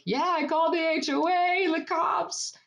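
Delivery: high, projected mock phone boast; pointed announcing. Woman re-enacting phone call to sister for neighbor's ears, high projected voice. Loudly meant for neighbor to overhear.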